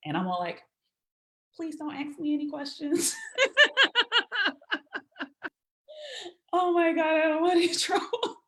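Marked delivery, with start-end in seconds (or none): 1.6-8.4 higher, half-laughing plea; laughing nervously. Retold or imitated. Guest retells begging at the club door, laughing. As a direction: giggle through a nervous plea.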